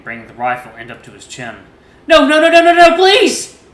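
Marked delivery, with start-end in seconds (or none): 2.1-3.6 very high, loud slow screams; terrified scream. A narrator's reading. Son screams as Dad raises the rifle, terrified. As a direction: scream in terror.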